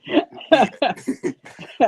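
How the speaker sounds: high, loud burst of real laughter; a real belly laugh. Real, not acted. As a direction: let a big laugh out. Laughing at uncle's story.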